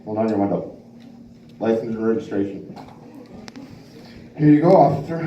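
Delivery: put-on lisped drunk driver lines; slurry lisp with a laugh; slushy sh for s. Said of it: "if you get pulled over".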